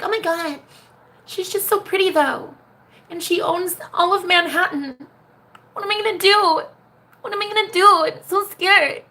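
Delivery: high, whiny mock of an anxious girl. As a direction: whimper it out like a jealous girlfriend. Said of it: "you're like".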